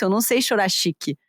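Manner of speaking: ordinary delivery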